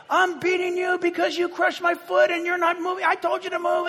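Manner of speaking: high, shouted cranky prophet; angry yell. Acted. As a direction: shout at the stubborn donkey. Balaam yells at the donkey.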